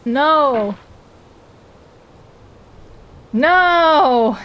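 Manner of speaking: loud, high cry of frustration; frustrated shout. Real, not acted. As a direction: yell no in dismay. Failing in the game.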